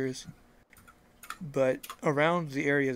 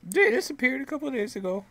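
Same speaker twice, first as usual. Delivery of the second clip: odd farmer voice; 10 semitones higher, 6 dB louder, faster than usual. Acted.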